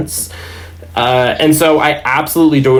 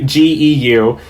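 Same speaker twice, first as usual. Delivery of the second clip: ordinary talk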